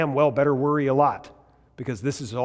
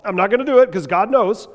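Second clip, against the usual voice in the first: higher, louder, with put-on determination; mock resolve; 8 semitones higher, 9 dB louder than usual. Preacher mimicking a believer's forced resolve, higher and louder. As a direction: declare it like a brave new resolution. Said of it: "Not just say".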